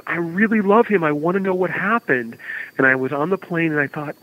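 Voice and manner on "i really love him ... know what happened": lightly voiced eager schoolkid; a hint of a pleading kid, pitched higher; earnest kid. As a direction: imitate a keen young fan. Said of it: "a kid puts up his hand".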